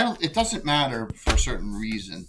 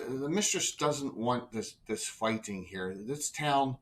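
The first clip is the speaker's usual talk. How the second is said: soft, slow, ominous voice; quiet menacing priest; 3 semitones lower, 12 dB quieter, slower than usual. Acted. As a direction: murmur it like a cold fanatic. Priest villain speaks.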